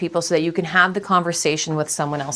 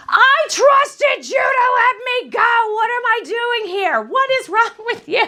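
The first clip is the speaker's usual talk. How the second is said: much higher, louder, slow indignant yell; outraged shout; 3 dB louder, slower than usual. Retold or imitated. Ninety-two-year-old aunt waking up yells at her lawyer, outraged high shout. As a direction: blast it like a furious woman who was meant to die. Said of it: "this is the client in full force".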